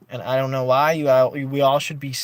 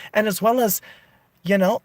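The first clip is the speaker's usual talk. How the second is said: ordinary delivery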